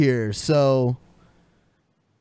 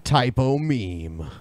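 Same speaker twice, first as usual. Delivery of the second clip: mock echo low; 5 semitones lower, 4 dB quieter, slower than usual. Echoing the typo.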